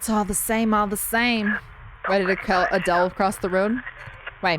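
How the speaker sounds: normal talking voice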